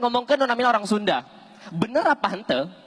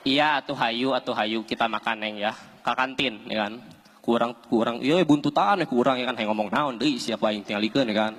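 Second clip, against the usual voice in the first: put-on much lower, mumbling young man; deep boyfriend voice; 10 semitones lower than usual. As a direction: drop low as the lazy boyfriend. Boyfriend answering his girlfriend. (sora lalaki handap ngawalon kabogohna)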